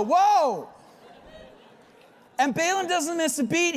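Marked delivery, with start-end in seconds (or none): 0.0-0.7 high, loud startled cry; startled whoa. Retold or imitated. A man hearing his donkey speak, loud startled whoa. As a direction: jump back with a big whoa. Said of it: "you'd at least go".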